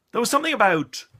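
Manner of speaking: normal talking voice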